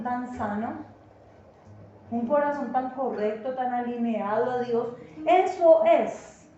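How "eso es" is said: slow, louder, higher "eso es"; preacher's loud drawn-out approval; emphatic affirmation. Acted. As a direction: drag out a booming eso es.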